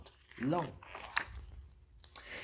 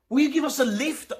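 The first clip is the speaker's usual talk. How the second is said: eager sailors; 11 semitones higher, 5 dB louder, faster than usual. A narrator's reading. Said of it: "asked the sailors".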